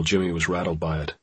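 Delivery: ordinary delivery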